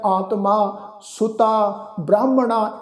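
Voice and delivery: ਆਤਮਾ, ਸੁਤਾ, ਬ੍ਰਾਹਮਣਾ each ending in long "aa"; kanna tone. Shown as a demonstration. Teacher explains a scholar's rule, final "aa" on ਆਤਮਾ, ਸੁਤਾ, ਬ੍ਰਾਹਮਣਾ. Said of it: "ਕੰਨੇ ਦੀ tone ਵਿੱਚ ਉਚਾਰਨ".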